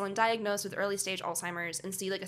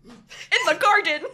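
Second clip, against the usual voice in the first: slow, loud, higher laughing shout; shouted punchline; 8 semitones higher, 7 dB louder, slower than usual. Acted. Capping the joke for co-host, shouted punchline. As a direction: belt it out slowly through laughter.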